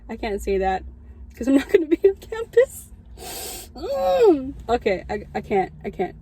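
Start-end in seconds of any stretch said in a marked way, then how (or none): 1.3-4.5 choked up. Real, not acted. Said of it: "I'm gonna start crying again".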